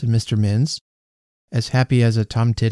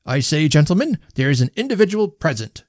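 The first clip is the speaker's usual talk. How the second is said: higher-pitched resumed toast; insistent speechmaker; 5 semitones higher than usual. A narrator's reading. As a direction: take up the speech again, insistently. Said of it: "resumed the host".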